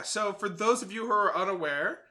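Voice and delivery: ordinary delivery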